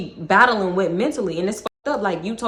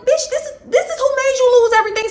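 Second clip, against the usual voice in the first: high disbelieving sneer; incredulous; 3 dB louder than usual. Retold or imitated. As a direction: scoff in disbelief. Her thought at him, incredulous.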